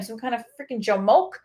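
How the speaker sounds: ordinary talk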